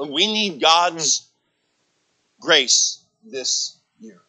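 loud, slow preaching; full-voiced sermon delivery. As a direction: proclaim it from the pulpit. Preaching climax.

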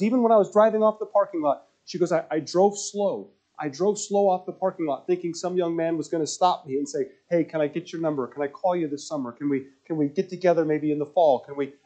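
a hint of a young woman's earnest plea; young woman voiced.

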